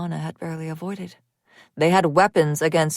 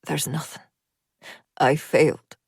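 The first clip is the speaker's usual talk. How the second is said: dying man rasping his failure; 2 semitones lower than usual. A narrator's reading. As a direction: rasp it out, giving up. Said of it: "he rasped".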